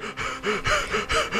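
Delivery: high gasp. Pretend. Acted gasp.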